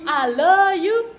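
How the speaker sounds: drawn-out loud love you, love stressed; loud, slow I love you. Shown as a demonstration. Vlogger signs off to viewers, loud and drawn out. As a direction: belt out the love. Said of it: "repeated sign-off, bigger take".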